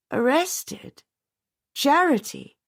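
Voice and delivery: high startled disbelief; shocked. A narrator's reading. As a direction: gasp it out in shock. Widow shocked by news.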